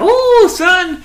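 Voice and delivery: high, loud drawn-out whoop; comic high-pitched holler; Joe Biden. Acted. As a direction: cry out in hammed-up delight. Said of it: "Joe Biden's gonna be like".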